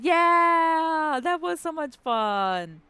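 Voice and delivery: big thrilled yell, high and loud; excited shout. Real, not acted. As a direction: cheer it out at full volume. Celebrating girls' night out.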